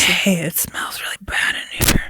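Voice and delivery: hushed ghostly whisper. Retold or imitated. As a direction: whisper it like the spirit recording. Echoing the ghost recording.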